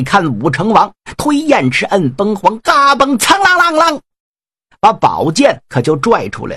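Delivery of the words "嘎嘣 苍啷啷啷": high ringing sword-unsheathing noise; storyteller mimicking a drawn sword; sword-drawing clang. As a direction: ring out the blade leaving its scabbard. Sword drawn, sound imitated.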